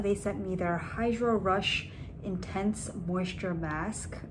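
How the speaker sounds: normal talking voice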